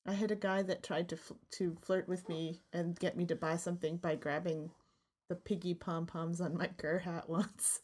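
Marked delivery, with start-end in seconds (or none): none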